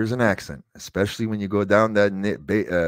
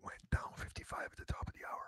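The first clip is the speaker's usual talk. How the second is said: whispered in dismay; 21 dB quieter, faster than usual. Acted. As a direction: breathe it out in disbelief. Dismay at ratings drop.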